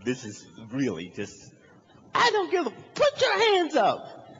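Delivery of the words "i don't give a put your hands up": loud cop command, voice pitched way up; shouting policeman. Retold or imitated. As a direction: bellow over the suspect's excuses. Cop yelling orders at him.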